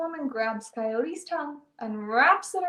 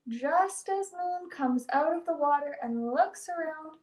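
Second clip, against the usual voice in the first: usual speaking voice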